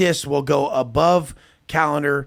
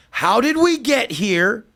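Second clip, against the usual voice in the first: patronizing slow question; 7 semitones higher, 3 dB louder, slower than usual. Retold or imitated. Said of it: "I'm like".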